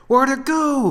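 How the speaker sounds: very high, loud, panicked; frightened soldier. Acted. Soldier losing sight of the beast, high panicked cry. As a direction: cry out in alarm.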